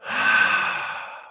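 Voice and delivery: long exhale, imitating release; imitated sigh of deep relief; loosening sigh. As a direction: let the body go on one long breath out. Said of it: "seeing her body loosen up".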